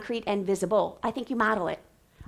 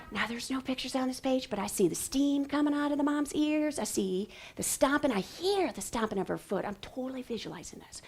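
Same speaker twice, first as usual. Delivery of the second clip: very soft, private thinking aloud; whispered thinking voice; 7 dB quieter than usual. Shown as a demonstration. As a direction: murmur your thoughts as an aside. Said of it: "I lean over here and I whisper".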